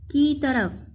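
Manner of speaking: normal talking voice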